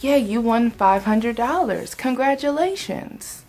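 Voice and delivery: upbeat group congratulations, lifted at the end; cheery, stretched-out congrats; congratulating. Retold or imitated. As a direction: imitate excited coworkers congratulating a winner. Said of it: "So they're like".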